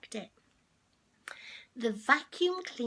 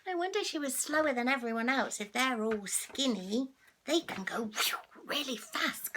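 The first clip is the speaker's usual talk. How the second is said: ordinary delivery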